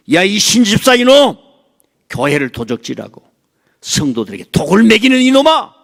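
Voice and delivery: high, loud scolding yell; furious rebuke. Acted. Jesus rebuking the preacher by name, furious shout. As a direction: tear into him like an angry master. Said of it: "신동체 목사를 불러가".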